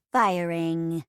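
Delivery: low, drawn-out robot announcement; robot assistant. Acted. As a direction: announce it like a ship's computer, low and even. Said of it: "I'm your robot assistant".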